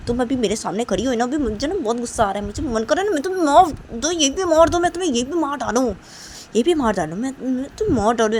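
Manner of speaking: loud, fast furious ranting; furious outburst. Acted. Nisha screaming at the girl who wronged her, furious. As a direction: yell that she can't stand her, wants to kill her.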